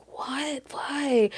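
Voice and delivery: whispered startled question. Retold or imitated. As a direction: whisper it, half awake and alarmed. Boyfriend just woken up.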